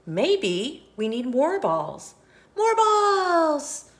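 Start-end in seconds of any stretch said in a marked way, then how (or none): 2.6-3.9 high, loud eager shout; excited. Acted. Friends cheer the new plan, high and loud.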